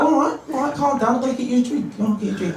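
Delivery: high-pitched, defensive backing down; acted hasty appeasing; placating mate. Acted friend placating him.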